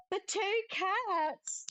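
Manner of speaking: high, slow, breathless delight at the cats; delighted gasp. Acted. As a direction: gasp and stretch it out in wonder.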